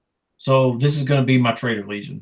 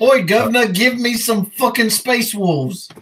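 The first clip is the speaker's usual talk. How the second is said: mock-furious British geezer, pitched way up; angry British voice; 9 semitones higher, slower than usual. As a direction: put on an angry British accent, drawing out the demand. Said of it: "imagine that in a very angry British voice".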